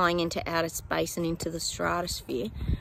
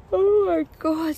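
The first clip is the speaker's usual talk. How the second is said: yawning; 11 semitones higher, 7 dB louder, slower than usual. Real, not acted. Yawning mid-reading, then apologises.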